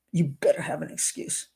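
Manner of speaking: You better have an excuse hissed in a low whisper; menacing whisper. Retold or imitated. Showing how nasty the fiance's text is, creepy whisper. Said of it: "he's being nasty to her".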